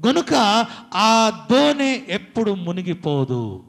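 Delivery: loud, high and slow, every word weighted; slow, loud pulpit declaration; slow preaching proclamation. Acted. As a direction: proclaim it slowly so it sinks in. Preacher drives home the faith point.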